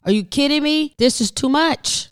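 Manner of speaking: high, exasperated outburst; too much to take, voice pitched high; exasperated disbelief. Real, not acted. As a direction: throw up your hands at it all.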